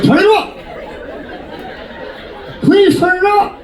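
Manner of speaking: very high begging cry; high-pitched plea. Acted. Client in massage chair calling out, high-pitched plea.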